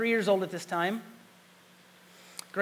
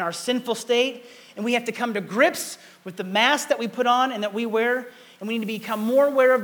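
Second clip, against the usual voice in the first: louder, driving preacher's call; full-force preaching; 4 semitones higher, 8 dB louder than usual. Acted. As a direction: push the congregation to face the mask. Preaching on struggle and masks.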